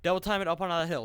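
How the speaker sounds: loud, urgent command; shouted order. Real, not acted. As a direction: hurry them up the hill. Urging squad uphill.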